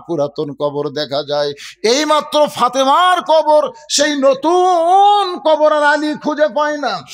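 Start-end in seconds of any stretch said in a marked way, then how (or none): 1.8-7.0 high, urgent sermon narration; frantic preaching. Acted. Preacher telling of Ali seeking Fatema's grave, frantic preaching. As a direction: cry out the search at a raised pitch.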